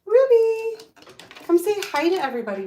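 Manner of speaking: high, loud call to a dog. Real, not acted. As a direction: call the puppy across the room. Calling the puppy.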